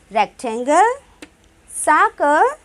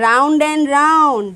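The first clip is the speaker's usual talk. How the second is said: "Round and round" stretched slowly and a bit louder; slow and drawn out; 4 dB louder, much slower than usual. Shown as a demonstration. Teacher describing a circle's shape to children. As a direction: draw the words out like tracing a circle. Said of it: "describing circle".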